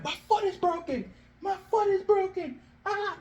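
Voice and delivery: high, thin mock wail of agony; screaming in pain. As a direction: act out agony in a high, held-back yelp. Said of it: "He's screaming in pain".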